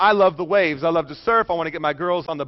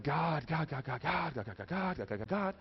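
desperate hushed prayer; 13 dB quieter than usual. Retold or imitated. I'm saying, praying.

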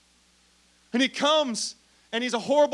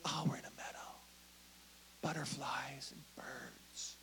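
dreamy whisper; 7 semitones lower, 18 dB quieter than usual. Retold or imitated. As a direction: whisper it like a daydream. Dreamy meadow fantasy.

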